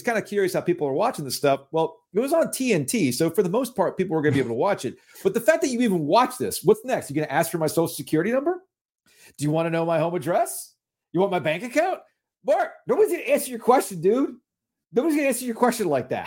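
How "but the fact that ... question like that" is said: high, fast indignant rant; mock outrage. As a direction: tell him off in disbelief, voice climbing. Mock outrage at Cuban's question.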